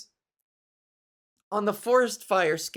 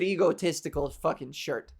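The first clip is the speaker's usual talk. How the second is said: ordinary talk, nothing marked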